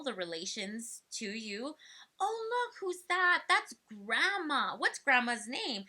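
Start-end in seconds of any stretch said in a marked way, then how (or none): 2.2-5.8 high, sing-song prompt over a photo; parent prompting child. Shown as a demonstration. Parent showing family pictures to a child, high and sing-song. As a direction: coax the child to name Grandma. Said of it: "have them say names of people".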